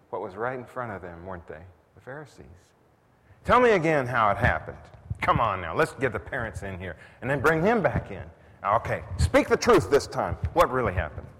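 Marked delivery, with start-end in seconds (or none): 3.4-11.3 loud, badgering questions; demanding interrogation. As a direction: grill him like a suspicious interrogator. Preacher acts out the Pharisees' interrogation.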